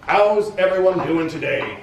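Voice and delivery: a general greeting troops, a bit too loud; booming cheerful officer. Acted. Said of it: "in his too loud voice".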